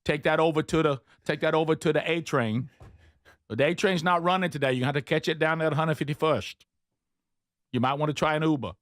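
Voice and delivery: pushy New York local giving directions; hammed-up New Yorker; mock New York accent. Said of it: "I started talking like New Yorkers".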